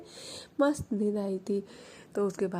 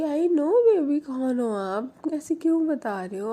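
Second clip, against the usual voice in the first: high, loud, sing-song; squealed sleepy reply; 7 semitones higher, 4 dB louder than usual. Acted. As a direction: chirp it out, still dreaming. Mani answering in her sleep. (नींद में चहकती ऊँची आवाज़)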